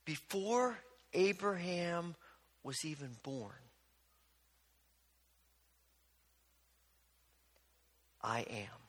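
solemn hushed reveal; hushed and weighty. Retold or imitated. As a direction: let the revelation land quietly. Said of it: "than to simply say".

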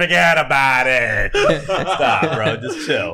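slow Boston "Forget about it"; Boston accent. Pretend. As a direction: lean into the Boston drawl. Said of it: "So I'm giving a Boston accent".

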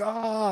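high, soft mock wail of pain; badly faked "ow, it hurts" moan; faked pain cry.

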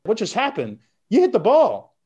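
high, astonished disbelief; stunned when the bat finally cracks; surprised. Retold or imitated. Batter realizing the hit, high astonished voice. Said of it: "You're like".

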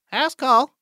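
high-pitched call at the door. Acted. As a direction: call in to whoever is home. Calls out while knocking on door.

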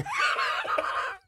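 very high imitated cackle; mock laughing. Friend mocking the laughing emoji, shrieky imitation laugh. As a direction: burst into a put-on laugh. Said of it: "mess it up even worse by going".

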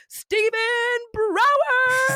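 high, drawn-out announcer shout; guest announcement. Real, not acted. Podcast host introducing the guest to listeners, drawn-out fanfare. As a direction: belt out the name in a long high call.

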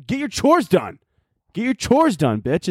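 high-pitched, exasperated order; mock scolding. Telling the orphan to do chores, mock scolding.

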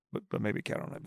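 whispered joking aside; whispering. Acted. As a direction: slip it in under your breath. Joking aside after a caveat.

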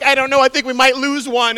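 loud, high, deadpan doom; mock grave. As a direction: keep fooling her. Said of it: "I'm like".

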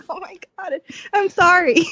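high, flustered "oh my God, I'm sorry"; mock embarrassed gasp of an apology. To the rider changing in the stall, high flustered apology. Retold awkward apology in barn.

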